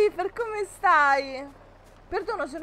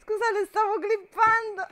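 normal talking voice

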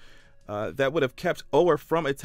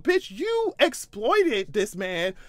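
slow, loud, high-pitched stretching of decades; outraged yell; 7 semitones higher, 6 dB louder, much slower than usual. Real, not acted. Vlogger denouncing the family, slow outraged high yell. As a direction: drag decades out in fury.

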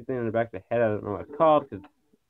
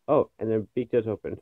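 ordinary talk, nothing marked

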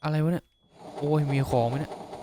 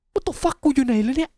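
bewildered outburst; 11 semitones higher, 3 dB louder, slower than usual. Real, not acted. Lost in the dark game map.

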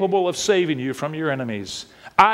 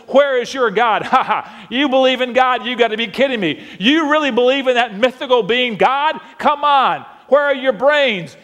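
lightly voiced taunting scoffer; mocking enemy; 8 semitones higher, 4 dB louder than usual. Enemy scoffing at the believer, lightly mocking. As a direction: sneer at the believer a little. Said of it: "as the enemy mocks and says".

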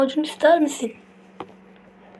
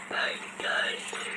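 creepy whisper; 13 dB quieter, slower than usual. Acted. Character announces arrival.

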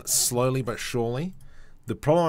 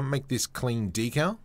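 ordinary delivery

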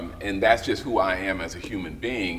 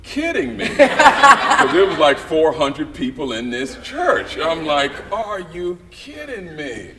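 higher, laughing through it; can't believe it, cracking up; laughing disbelief; 10 semitones higher, 10 dB louder, slower than usual. Retold or imitated. Laughing disbelief at locked church.

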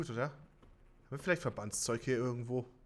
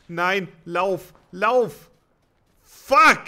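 panicked shouting; being chased; 7 semitones higher, 15 dB louder, much slower than usual. Real, not acted. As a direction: yell high and loud while fleeing. Fleeing the closing zone.

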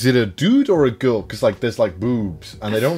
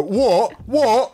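imitating Emily; 9 semitones higher than usual. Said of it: "Emily always go".

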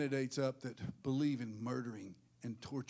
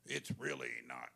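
low, slow put-on drawl; mock whiny-slow church member, pitched low; mocking excuse-maker; 3 semitones lower, 4 dB quieter, slower than usual. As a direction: drag it out like a dim objector. Mimics church member making excuses.

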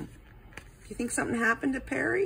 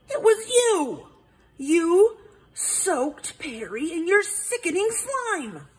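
high, triumphant accusation; owl detective voice; 8 semitones higher than usual. Acted. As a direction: point the finger, gleeful. Hubert accusing.

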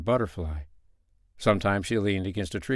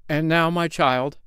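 higher, slow, lightly voiced little man; little brown man crying out; 7 semitones higher, 4 dB louder than usual. A narrator's reading. Little man turning to the girl, crying out. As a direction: cry it slowly, pitched up. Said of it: "cried he".

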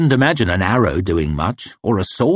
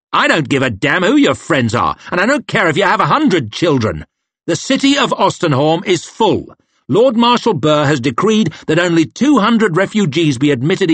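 high, loud, dismissive lad; arrogant young officer; 8 semitones higher, 3 dB louder than usual. Acted. Young officer turning away refugees, loud and sneering.

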